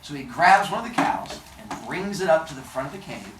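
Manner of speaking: usual speaking voice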